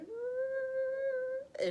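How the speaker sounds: loud, high-pitched mock wail; long exaggerated crying whimper; retold crying. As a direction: imitate bursting into tears with a long high whine. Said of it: "and started crying".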